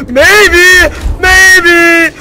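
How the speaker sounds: sudden shouted maybe; yelled. Real, not acted. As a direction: belt it out. Repeats word, then yells it.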